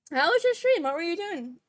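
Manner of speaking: high, loud eager question; excited. Real, not acted. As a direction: ask with eager delight. Greeting the raider.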